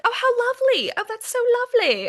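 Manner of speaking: high, sugary gush of fake sympathy; airy mock-posh delight. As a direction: mimic the oblivious friends, all sweetness. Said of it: "they kind of think like".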